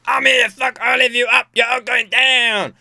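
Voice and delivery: high, loud shout, stretched at the end; mock threat. Someone storming into a club, mock threatening shout. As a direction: yell it like a yappy would-be tough guy. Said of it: "if someone walks into, like, a club".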